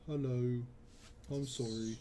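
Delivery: playful, rough attempt at an English accent; put-on English accent. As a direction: try on a hammy English accent. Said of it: "the English accents are really coming along".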